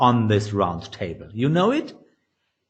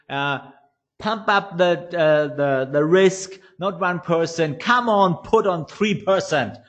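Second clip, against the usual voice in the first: greedy guy; 4 semitones higher than usual. Acted. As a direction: jump in over everybody and urge hard. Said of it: "the greedy guy jumps up and says".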